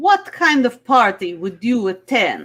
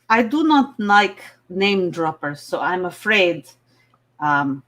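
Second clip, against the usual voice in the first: put-on heavy Russian accent; 6 semitones lower than usual. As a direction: play it as a Russian contestant. Miss Russia.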